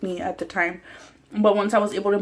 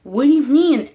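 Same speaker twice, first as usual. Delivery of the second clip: mom's startled call, higher and louder; alarmed mom; 5 semitones higher, 7 dB louder than usual. Retold or imitated. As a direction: shout it out like a worried mother. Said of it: "my mom was like".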